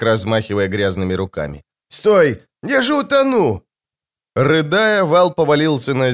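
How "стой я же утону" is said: high-pitched wail of protest; panicked yell. A narrator's reading. Mak begging them to stop, yelling in panic. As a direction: cry out as if about to drown. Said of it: "завопил Мак".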